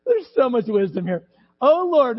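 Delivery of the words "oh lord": pleading. Acted. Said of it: "they pleaded".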